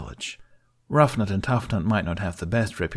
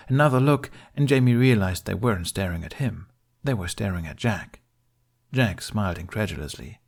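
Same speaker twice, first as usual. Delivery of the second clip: ordinary delivery, nothing marked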